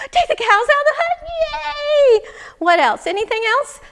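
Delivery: high, loud, fast delight; overjoyed. Acted. Man hearing wise woman free him of cows, elated. Man rejoices, asks for more.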